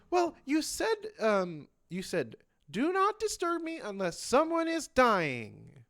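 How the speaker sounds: high, loud mock-indignant protest; indignant Mina. Mina protesting to the doctor, high and indignant. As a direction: quote the doctor's own order back, outraged. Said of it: "Mina goes".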